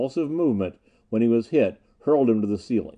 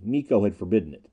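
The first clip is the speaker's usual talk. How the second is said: ordinary delivery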